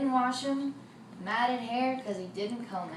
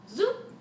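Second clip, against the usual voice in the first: a high, louder zoop; rising zoop effect; 9 semitones higher, 4 dB louder than usual. A narrator's reading. Storyteller whisks away the chair, high zoop. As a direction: flick it up like a magic trick. Magic wand sound.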